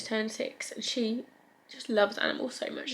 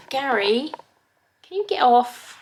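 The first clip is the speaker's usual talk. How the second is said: louder, exasperated telling-off; scolding a pet; 2 semitones higher, 9 dB louder than usual. Real, not acted. Owner telling Gary the cat to get off, scolding. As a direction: call the cat off firmly and loudly.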